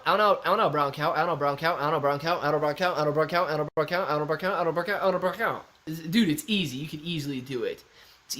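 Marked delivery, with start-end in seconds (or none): 0.1-5.6 run-together repetitions in a steady rhythm; rapid-fire tongue twister. Shown as a demonstration. Streamer testing a tongue twister, rapid-fire. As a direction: rattle it off over and over without pausing.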